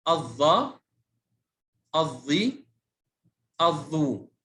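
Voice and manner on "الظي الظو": ordinary talk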